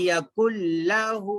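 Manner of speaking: यकुल लहू with held l and long final oo; merged lam recitation. Shown as a demonstration. Teacher giving the next example, merged lam. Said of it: "यकुन लहू नहीं पढ़ेंगे".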